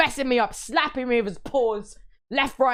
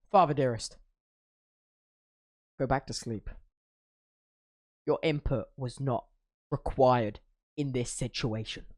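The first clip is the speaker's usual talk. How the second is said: lowered posh voice; 9 semitones lower, 4 dB quieter than usual. Retold or imitated. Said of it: "I say that in my head".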